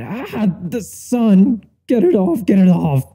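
high, hammed-up cry of sun-blinded agony; panicked yelp. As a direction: shriek as if the sunlight burns. Hungover narrator hit by sunlight.

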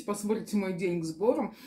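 ordinary talk

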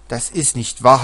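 loud, outraged denial; shouted denial. A narrator's reading. Isabella denying the accusation, loud shout. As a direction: yell it back in protest. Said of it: "hat Isabella geschrien".

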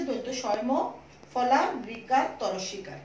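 usual speaking voice

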